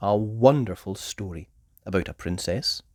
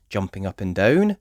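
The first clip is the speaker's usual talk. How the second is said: plain reading voice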